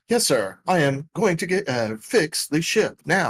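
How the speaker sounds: prim droid obediently answering an order; C-3PO. Acted. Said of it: "in C P three oh voice".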